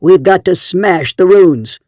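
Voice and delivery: rally the crowd, slowly and loud; fierce shout. A narrator's reading. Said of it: "with fierce agreement from the crowd".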